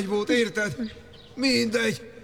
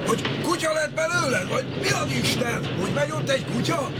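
ordinary delivery

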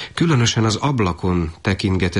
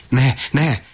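higher-pitched, urgent exclamation; restrained cry of alarm; 7 semitones higher, 4 dB louder than usual. A narrator's reading. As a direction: cry out to stop him, a little louder. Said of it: "kiáltott fel a katona is".

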